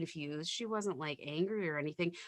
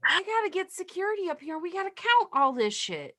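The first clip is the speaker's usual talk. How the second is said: high, raised mock alarm; pitched up, louder, all business; urgent nurse; 9 semitones higher, 6 dB louder than usual. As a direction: act out an exasperated call for backup. Nurse reacting to pills in alarm.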